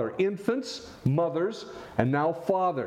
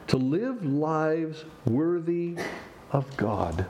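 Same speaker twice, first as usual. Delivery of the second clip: slow emphatic repeat; much slower than usual. Real, not acted. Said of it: "repeats phrase slowly for emphasis".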